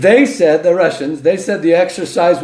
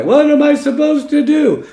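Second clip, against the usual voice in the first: mock worried viewer; 10 semitones higher than usual.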